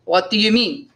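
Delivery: mildly surprised innkeeper. A narrator's reading. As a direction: ask with a touch of surprise. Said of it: "asked Caderousse with surprise".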